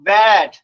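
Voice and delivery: "ব্যাট" as one loud held syllable; blended word. Shown as a demonstration. Teacher completing the phonics demo, loud blended "ব্যাট". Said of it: "যদি সে পড়তে".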